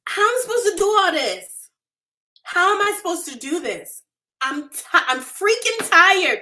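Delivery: high, exasperated outburst; retold overwhelmed cry, pitched high; angry yelling at God. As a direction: plead and holler, at the end of your rope. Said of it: "I yelled out to God".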